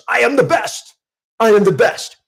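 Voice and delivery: high, loud, forceful declaration; declared loud. Shown as a demonstration. Coach showing a declaration versus a mere affirmation, loud and high. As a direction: proclaim it with full conviction. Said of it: "A declaration is".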